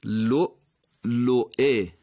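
لؤلؤه very slowly, "luʾ-luʾi"; two hamzas articulated. Shown as a demonstration. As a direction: catch both glottal stops in لؤلؤه. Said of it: "دو تا همزه دارید".

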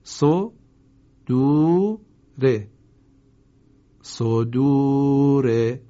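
slow, loud, vowels held long; drawn-out recitation. Shown as a demonstration. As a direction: recite each syllable stretched. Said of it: "کلمهٔ بعدی".

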